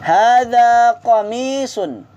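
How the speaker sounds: slow, drawn-out Arabic drill phrase; slow model for repeating. Shown as a demonstration. Teacher models the phrase slowly for students to repeat. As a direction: spell out each syllable for the class to echo. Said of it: "drill model for repeating".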